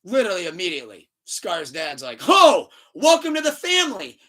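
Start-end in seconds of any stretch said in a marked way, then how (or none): 2.3-4.2 high, loud mock-dad greeting; hammed-up fatherly fanfare; exuberant welcome. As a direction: boom out a delighted welcome, imitating a proud father. Scar's dad welcomes Mufasa, retold.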